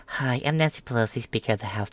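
lightly voiced crisp, composed politician; a hint of a clear, put-together Speaker; Nancy Pelosi before. Mocking Pelosi as she used to sound, composed. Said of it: "Pelosi went from speaking like".

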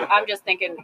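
usual speaking voice

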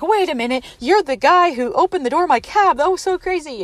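high, loud retold excitement; excited recognition. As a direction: blurt it out as it dawns on you. Retold sudden recognition of a stranger.

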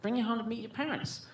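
put-on high, pleading girlfriend voice; girlfriend's voice. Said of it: "So she's like".

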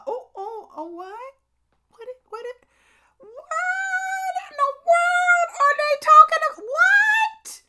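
very high, loud cry of disbelief; shocked mama. Retold or imitated. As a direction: shriek it like the phone news floored you. Retold shock at a phone call.

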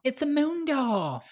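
high, drawn-out squeal; mock character voice. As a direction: stretch it out high and slow. Mimics a character's moon door line.

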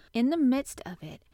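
ordinary delivery

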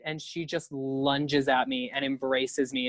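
ordinary talk, nothing marked

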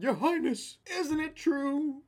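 high, strained donkey squeal at the king; screaming donkey. Acted. Donkey pleading to the lion king, high hysterical squeal. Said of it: "the donkey starts screaming, like, hooting and hollering".

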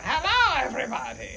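high-pitched cartoon villain greeting; Doofenshmirtz. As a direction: greet them as a hammy evil scientist. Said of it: "He tries to do a Doofenshmirtz accent".

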